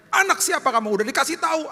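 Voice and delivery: high, sharp, scolding parent; mock parental scold. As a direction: snap at the kids like an exasperated parent. Parent scolding child, rejected way.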